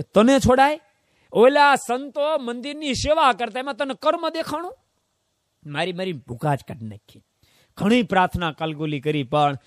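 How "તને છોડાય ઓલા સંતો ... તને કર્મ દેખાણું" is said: high, loud sneering question; a Yama messenger gloating; menacing taunt. Acted. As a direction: taunt the captive before the beating. Death messengers mocking him.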